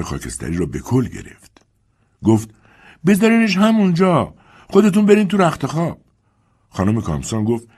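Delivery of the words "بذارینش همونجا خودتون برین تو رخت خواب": put-on high, raised old servant voice calling upstairs; Dilsey calling up. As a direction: call up the stairs to the mistress.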